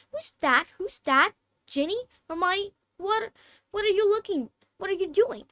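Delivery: put-on high, alarmed young girl; startled girl's voice. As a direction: call out nervously in the dark. Ginny voiced, startled in dark.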